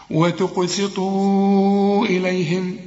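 "وتقسطوا إليهم" slowly, final oo held long and level before "إليهم"; long madd. Shown as a demonstration. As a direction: sustain the oo, then join on. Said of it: "مد أربع حركات".

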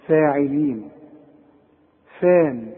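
فاعلين with a thin, fronted "fae"; measured, light vowel after ف in فان; correct light Fa. Shown as a demonstration. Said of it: "اقراها صح".

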